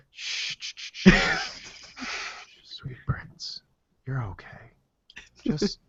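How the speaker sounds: breathy sweet-talk from a company coaxing a customer; a teasing hushed whisper, high and soft; mock seductive whisper. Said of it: "Rogers whispered sweet nothings".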